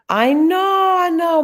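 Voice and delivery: loud, high burst of I know, I know; excited outburst. Real, not acted. Admitting she's been away.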